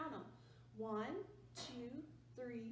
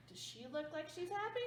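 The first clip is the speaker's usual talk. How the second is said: plain reading voice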